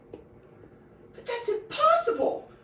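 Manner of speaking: high, slow, loud wail. A narrator's reading. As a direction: wail it like a spoiled child.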